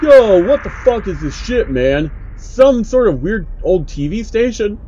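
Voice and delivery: put-on loud, high, jeering young guy; mock it like a rude teenager posting; brash online commenter. Commenter reacting to the download link, loud and jeering. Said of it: "Cora Oppola".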